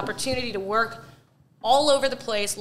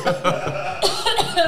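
real coughing after choking on a drink; sputtering cough, drink down the wrong way; coughing fit; 7 semitones higher than usual. Real, not acted. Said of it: "I went down the wrong way".

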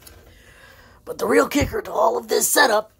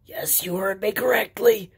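ordinary talk, nothing marked

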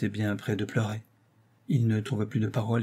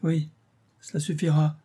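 higher-pitched moaning assent; 6 semitones higher than usual. A narrator's reading. Financier gives in to the actress, moaning. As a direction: concede it with a groan. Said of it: "gémit Saint-Renaud".

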